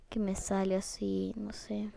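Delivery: whispered embarrassed aside. Real, not acted. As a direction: whisper it shyly. Self-conscious aside.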